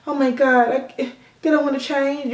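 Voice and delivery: high, fretful worrier voice; whining that they won't change; mock worried whine. To viewers, mocking a fretting person in a high whine.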